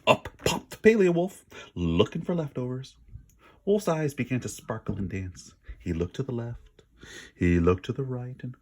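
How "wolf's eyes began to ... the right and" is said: plain reading voice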